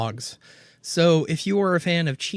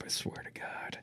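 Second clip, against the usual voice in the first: unvoiced exasperated whisper; 18 dB quieter than usual. Real, not acted. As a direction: whisper it through clenched teeth. Exasperated aside.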